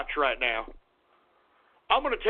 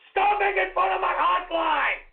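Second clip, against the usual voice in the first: angry yelling; 6 semitones higher than usual. Acted. Host yelling at mocking chat.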